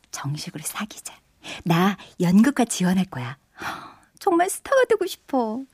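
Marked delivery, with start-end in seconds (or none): 0.1-1.1 whispering. Real, not acted. Shy confession of love.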